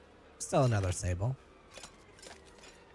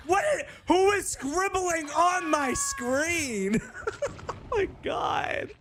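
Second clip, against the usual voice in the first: laughing shriek; 9 semitones higher than usual. Real, not acted.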